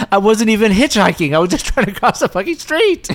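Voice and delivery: high-pitched mock protest; indignant victim. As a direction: protest in hammed-up dying indignation. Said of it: "'cause he'd be like".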